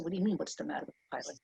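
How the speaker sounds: put-on quick, hushed reply in own accent; quiet retort. Passenger questions her seatmate about the pilot, hushed and quick.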